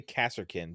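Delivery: "Kasserkin" with the S sounded before the K; pronounced Kasserkin. Shown as a demonstration. Guest gives his version of the name, "Kasserkin", S first. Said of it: "because the S is first".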